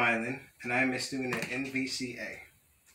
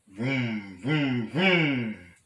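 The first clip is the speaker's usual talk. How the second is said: drawn-out vrooms, building louder; imitated engine vroom; 5 dB louder, much slower than usual. A narrator's reading. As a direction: rev it up like a motorbike. Engine noise read out in story.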